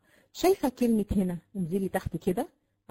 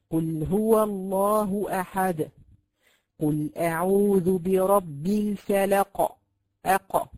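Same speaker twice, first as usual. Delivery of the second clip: slow, louder chanted recitation with qalqala; Quran recitation; 5 dB louder, much slower than usual. Shown as a demonstration. Teacher to students reciting verses as examples: slow chant. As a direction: recite it slowly, chanting each word. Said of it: "وهكذا".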